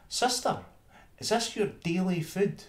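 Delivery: very high, haughty mouse voice; proud town mouse. Acted. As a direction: look down your nose at the meal. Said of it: "prompted forth in pride, and said".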